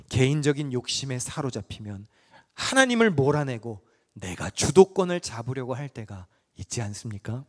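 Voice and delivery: ordinary talk, nothing marked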